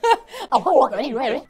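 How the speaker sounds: loud bright laugh; real laugh bursting out; burst of laughter. Real, not acted. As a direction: crack up at yourself. Laughs at her own joke.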